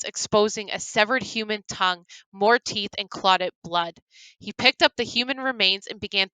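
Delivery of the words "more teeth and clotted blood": ordinary delivery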